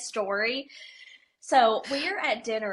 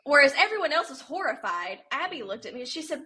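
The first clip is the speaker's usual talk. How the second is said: normal talking voice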